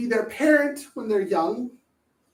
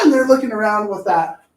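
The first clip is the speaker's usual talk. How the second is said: normal talking voice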